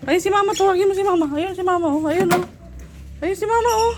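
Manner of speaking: excited pointing out. Real, not acted. Pointing out mother to toddler. (masiglang pagtuturo sa bata, mataas ang boses)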